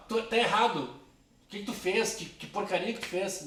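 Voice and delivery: scolding rebuke. Shown as a demonstration. Teacher demonstrating the wrong way to correct someone, loud scolding. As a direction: tear into the person for the mistake. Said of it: "Não dizer assim, ó".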